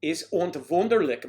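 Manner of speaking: a light mock German accent on Wunderlich, vunderlich; lightly hammed German accent. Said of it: "My apologies to those who speak with accents".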